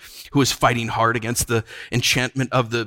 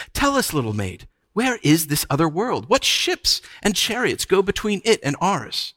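lilting questions, climbing higher; mocking witch; 7 semitones higher than usual. A narrator's reading. Witch questioning little maid about her world, mocking. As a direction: coax with feigned curiosity.

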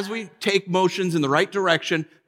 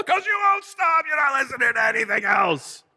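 mock shouted retort; 9 semitones higher than usual. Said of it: "his reply in the quarrel".

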